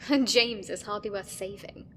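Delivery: laugh, then a loud, slow dig; mock him through a laugh; amused contempt; laughing scorn. Laughs at rival's fate.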